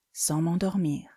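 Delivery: normal talking voice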